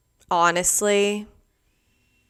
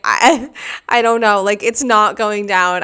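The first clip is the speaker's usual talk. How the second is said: flustered, stammering mock panic, a bit louder; 6 dB louder than usual. Imitating her own panic at the plane's overhead bin, flustered. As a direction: act out the stress of a stuck suitcase handle. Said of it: "I'm freaking out".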